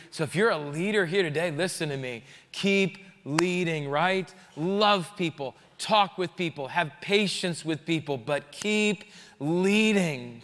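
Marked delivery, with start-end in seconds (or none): 2.5-3.9 slow, drawn-out keep leading; earnest, slow encouragement; slow exhortation. Real, not acted. Exhortation to leaders. 8.2-10.4 very slow, drawn-out keep leading; earnest, slow encouragement; slow exhortation. Real, not acted. Exhortation repeated.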